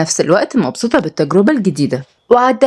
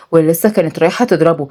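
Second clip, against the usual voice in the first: ordinary delivery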